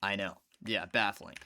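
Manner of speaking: ordinary talk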